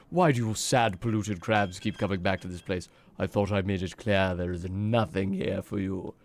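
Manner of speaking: low, brooding boss voice; menacing game villain. Acted. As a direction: read it as a villain scorning intruders, low and threatening. Reads game villain's dialogue aloud.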